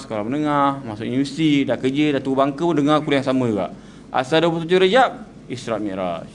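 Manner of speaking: usual speaking voice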